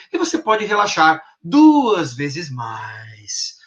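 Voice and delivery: DUAS and MAIS drawn out very slowly; fade-out. Shown as a demonstration. Hypnotist installs relaxation in someone, fading out. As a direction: let MAIS stretch and fade away. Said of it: "Eu vou fazendo um fade out no mais".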